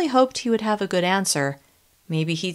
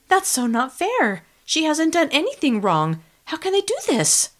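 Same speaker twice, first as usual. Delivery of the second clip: outraged; 9 semitones higher than usual. Acted. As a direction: plead against the injustice, outraged. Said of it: "My voice was filled with outrage".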